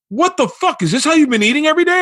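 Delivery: high-pitched, rushed disbelief; incredulous outburst. Retold or imitated. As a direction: shoot up high, scandalized at being left out. Acted shock at castmates' secret feasts.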